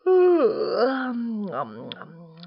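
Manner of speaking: big yawn falling into mumbles. Acted. Sleepy koala climbing down to Dingo, yawning. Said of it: "he yawned".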